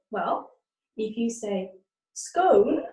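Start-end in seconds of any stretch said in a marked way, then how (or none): 2.1-2.8 "scone" with a long o, rhyming with bone; northern scone. Shown as a demonstration. Teacher showing how the north says scone, higher and louder. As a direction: stretch the o in "scone" like cone. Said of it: "that means you're from the north of England".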